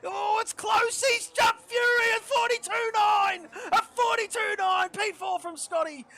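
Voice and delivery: loud, high play-by-play shouts; excited race commentary. Real, not acted. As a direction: call the finish like a live broadcast.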